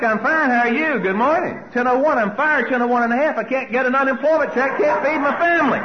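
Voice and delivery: high, loud, racing mock panic; comic frantic catastrophizing. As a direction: rattle it off in rising alarm. The panic spiral at its peak.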